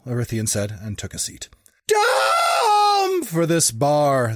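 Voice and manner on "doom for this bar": high, loud, stretched-out creaky cry; creaking old man's shout. A narrator's reading. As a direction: shout like an ancient doomsayer. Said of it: "the old man shouted, his voice creaking".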